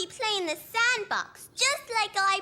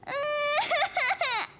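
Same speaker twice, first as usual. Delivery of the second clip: crying wail; 5 semitones higher than usual. Acted. Toddler left out, starts crying.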